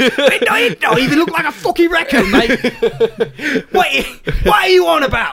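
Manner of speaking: laughing, indignant London bloke; British accent. Pretend. British character scolds narrator.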